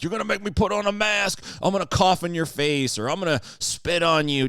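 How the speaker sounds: high, taunting jerk voice; mocking a defiant anti-masker; mock provocateur. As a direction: sneer it like a troll daring them.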